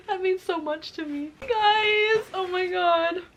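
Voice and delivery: high, tearful outburst; emotional, near tears. Real, not acted. Reader overwhelmed by a reunion scene, crying. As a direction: wail it to the viewers, overcome. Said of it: "it's making me so emotional".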